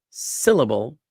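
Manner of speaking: "syllable" as SIL-uh-bul; stress on SI. Shown as a demonstration. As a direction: hit the first "si" of "syllable", let the rest drop. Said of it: "si is the emphasized".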